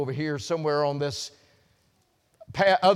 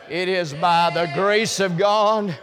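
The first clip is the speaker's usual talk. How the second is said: slow, louder, climbing much higher to a shout on period; full-force preaching; 7 semitones higher, 6 dB louder, slower than usual. Acted. Preacher to congregation, climbing to a shouted climax. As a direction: preach it harder with each phrase.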